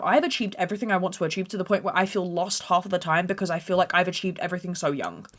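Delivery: ordinary talk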